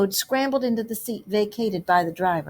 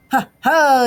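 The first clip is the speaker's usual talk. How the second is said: louder, higher triumphant whoop; gleeful cry; 5 semitones higher, 7 dB louder than usual. A narrator's reading. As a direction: crow with self-delight. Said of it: "he cried in ecstasies of self-admiration".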